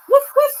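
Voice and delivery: high, loud yappy woofs; mock dog barks. Shown as a demonstration. Owner showing the dog spirit how to bark, high loud woofs. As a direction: bark like a little dog to show her how. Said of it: "Can you bark for me?".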